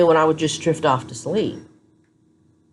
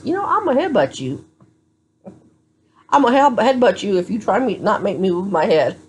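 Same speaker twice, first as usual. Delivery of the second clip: defiant threat; 5 semitones higher than usual. Retold or imitated. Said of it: "all I could think of was".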